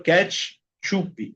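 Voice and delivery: ketchup as "ketch-UP-ee", in two chunks; added final I. Shown as a demonstration. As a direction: say ketchup Brazilian-style with an extra i at the end. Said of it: "agregarle una I a la sílaba".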